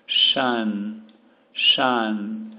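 "shun" said apart, short "a" sound; sounding out the ending. Shown as a demonstration. Teacher drilling the "shun" ending of impression. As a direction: isolate "shun" and hit it twice. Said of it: "Esse I-O-N é sempre an".

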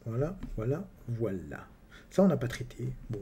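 usual speaking voice

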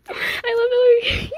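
high, loud, drawn-out fangirl squeal; excited squeal. Real, not acted. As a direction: squeal it out, overwhelmed with love. Fangirl squeal over photo book.

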